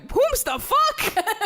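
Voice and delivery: very high, slow, laughing confusion; high laughing squeak. Retold or imitated. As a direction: squeak it out, baffled and cracking up. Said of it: "I was like".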